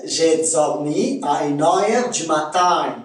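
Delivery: Québécois demo, "heure" as a-eur, "matin" as ma-taïn; Québécois accent. Shown as a demonstration. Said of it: "Je répète".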